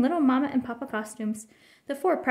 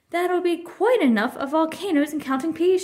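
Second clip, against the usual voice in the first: loud, higher scolding shout; mother snapping at the table; 6 semitones higher, 5 dB louder than usual. A narrator's reading. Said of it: "shouted Mama".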